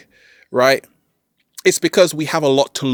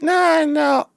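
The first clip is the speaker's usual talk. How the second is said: high squeaky no, no; mock cat voice; cat's no; 13 semitones higher than usual. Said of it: "The cat down the road said".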